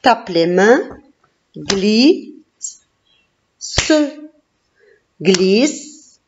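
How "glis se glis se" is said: Glisse split into "gli", pause, "se"; syllable by syllable. Shown as a demonstration. Teacher having pupil clap the syllables, Glisse split as gli... se. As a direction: give Glisse two beats, sounding the final se separately. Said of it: "fais le découpage syllabique".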